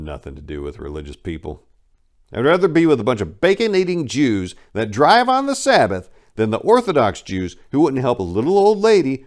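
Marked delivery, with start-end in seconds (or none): 2.3-9.3 louder and higher, spitting out the bitterness; indignant outburst. Real, not acted. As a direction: let the resentment boil over into a loud rant. Bitter rant against the Orthodox.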